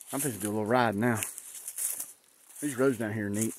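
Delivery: usual speaking voice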